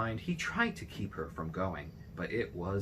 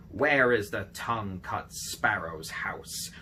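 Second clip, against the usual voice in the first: louder, slow call into the bamboo grove; 7 dB louder, slower than usual. A narrator's reading. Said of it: "crying out".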